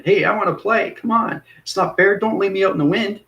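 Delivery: put-on high childish whine; whiny ego voice. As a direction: whine like a left-out kid. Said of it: "the ego always wants to jump in".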